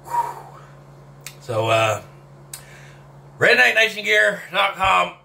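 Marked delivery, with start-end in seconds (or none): none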